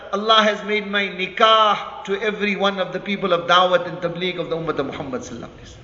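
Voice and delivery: ordinary talk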